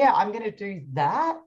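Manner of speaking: rising at the end of the line; Australian upward inflection. Retold or imitated. Imitating the mentor's rising sentence ending. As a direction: lift the end of the sentence up high. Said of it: "we go up at the end of our sentence".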